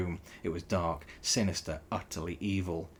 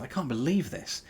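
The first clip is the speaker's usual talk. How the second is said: higher, sharp snap of disbelief; snapped; 7 semitones higher than usual. A narrator's reading. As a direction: snap it out, outraged. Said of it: "he snapped".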